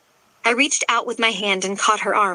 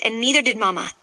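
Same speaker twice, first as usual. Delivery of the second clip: usual speaking voice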